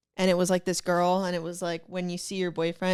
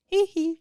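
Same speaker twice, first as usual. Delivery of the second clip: high giggle; 10 semitones higher than usual. Real, not acted.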